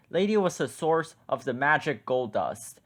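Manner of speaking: usual speaking voice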